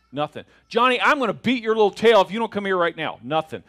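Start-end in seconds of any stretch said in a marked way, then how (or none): 0.7-3.2 loud, fast, higher mock threat; threatening parent. Parent threatening ignoring Johnny, mock angry. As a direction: rattle off a warning in exasperation. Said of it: "the parents that are like".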